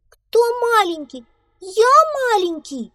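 very high, loud outraged squeal; offended little mouse protesting; indignant. A narrator's reading. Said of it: "возмутился мышонок". (возмущённо)

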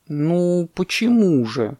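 slow, mild objection; gently drawn-out disagreement; elephant calf. Acted. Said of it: "сказал слонёнок".

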